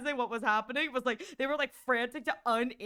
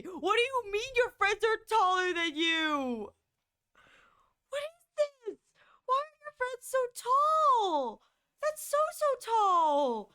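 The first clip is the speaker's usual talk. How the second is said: incredulous; 8 semitones higher, slower than usual. Real, not acted. Disbelief at tall friends.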